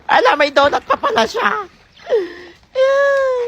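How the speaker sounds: ordinary delivery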